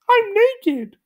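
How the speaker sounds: very high startled cartoon voice; squeaky shock; Gumby's high voice. A narrator's reading. Gumby finds he is naked, very high voice. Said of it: "Gumby said".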